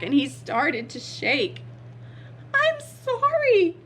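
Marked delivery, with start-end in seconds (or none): 2.5-3.8 high, loud frightened dragon; sputtered apology. Acted. Dragon facing the horrible beast, high frightened sputter. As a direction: squeak out a panicked, drawn-out sorry. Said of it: "he sputtered".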